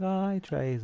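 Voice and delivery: high-pitched friendly hello; bright retold greeting.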